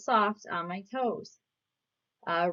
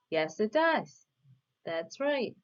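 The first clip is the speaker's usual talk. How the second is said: normal talking voice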